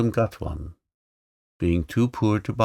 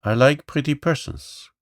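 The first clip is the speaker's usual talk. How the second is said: commanding; 5 semitones higher than usual. Acted. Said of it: "commanded the voice".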